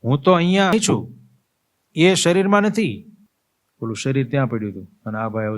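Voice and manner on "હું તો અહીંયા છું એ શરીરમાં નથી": calling out higher, slow and louder; a ghost begging the mourners to hear; pleading dead man. Acted. Dead man calls to his mourners, higher and pleading. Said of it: "હવે એ પોતે એને બધાને કહેવા માંડે".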